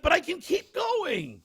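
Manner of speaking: high, drawn-out comic plea; mock-eager insistence. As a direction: act out a determined, strained protest. Retold insistence to keep going.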